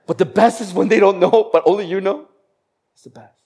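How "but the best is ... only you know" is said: higher, louder excited delight; excited emphasis. Real, not acted. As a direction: relish the secret. Excited peak of the point.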